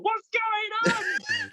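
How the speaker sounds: high, slow, drawn-out cry of bafflement; mock confused shout. Imagined reader calling out to the forum for help, high drawn-out mock shout. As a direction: wail it like a lost reader. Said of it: "you can ask people".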